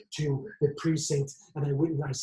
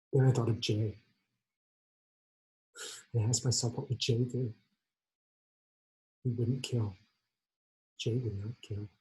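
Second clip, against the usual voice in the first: subdued reflection; 4 semitones lower, 5 dB quieter than usual. Acted. Remembers Jay, relents.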